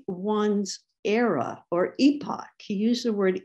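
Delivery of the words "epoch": EPOCH said the British way, as EE-pock; British-style EE-pock for EPOCH; British pronunciation. Shown as a demonstration. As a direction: say EPOCH like a Brit, long EE first. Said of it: "saying it like a Brit".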